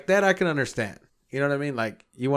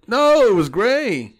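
emphatic; 10 semitones higher, 8 dB louder, much slower than usual. Real, not acted. Disgusted outburst about the carpet.

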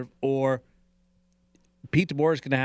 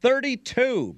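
excited; 14 semitones higher, 5 dB louder, slower than usual. Real, not acted. Echoing caller number excitedly.

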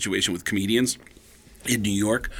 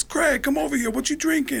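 high put-on celebrity voice; Tracy Morgan impression; 14 semitones higher than usual. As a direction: call someone over in high mimicry. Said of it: "then Tracy Morgan goes".

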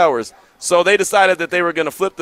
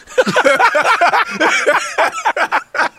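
cracks up with a high-pitched laugh; a real laugh, up high; high burst of laughter. Real, not acted. Host to listeners, breaking up at the punchline with high laughter.

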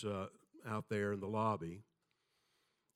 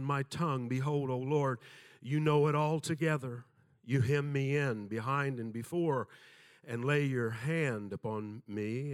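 scripture reading; 3 semitones higher than usual. Acted. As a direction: lift the words up as a prayer to God. Reads Psalm 139 aloud.

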